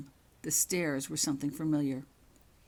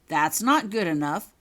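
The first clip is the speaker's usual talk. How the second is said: stern; 8 dB louder than usual. Acted. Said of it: "Richards told her".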